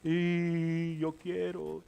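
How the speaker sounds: slow, drawn-out fake child whine; mock tantrum wail from a little kid; whiny child. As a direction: stretch it out like a kid crying without tears. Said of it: "son llantos sin lágrimas".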